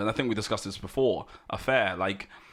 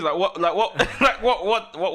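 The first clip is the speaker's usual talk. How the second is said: laughing; 7 semitones higher, 4 dB louder than usual. Real, not acted. Host laughing, pressing for details.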